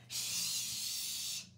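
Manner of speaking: sharp whispered shush; exclaimed shush. Acted. Bear hushes Mouse to hear the story, a sharp whisper. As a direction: hush him urgently. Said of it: "exclaimed Bear".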